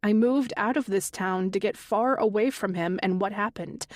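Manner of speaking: ordinary delivery, nothing marked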